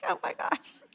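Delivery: whispered mock disbelief; exasperated aside. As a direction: breathe it out, giving up. Exasperated parent gives in.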